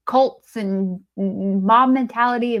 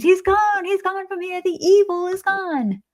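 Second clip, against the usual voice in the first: high-pitched, hammed-up cry of alarm; imitating a character's alarmed scream; mock scream; 10 semitones higher than usual. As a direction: act out a panicked scream, pitched way up. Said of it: "Loomis screams".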